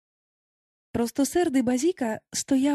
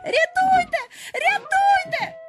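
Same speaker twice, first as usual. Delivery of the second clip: very high, loud cry for help; panicked scream; 5 dB louder, slower than usual. A narrator's reading. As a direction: scream for rescue, drawing out the second cry. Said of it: "зарепетував Хвалько". (відчайдушний високий крик)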